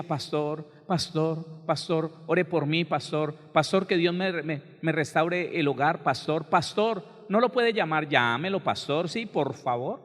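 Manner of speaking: insistent mock begging, building then easing; imitated pleading. Repentant wife begging the pastor to pray and call, imitated pleading. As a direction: mimic a wife who keeps imploring her pastor. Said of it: "Entonces vuelve en sí y empieza".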